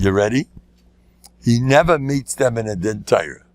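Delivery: ordinary talk, nothing marked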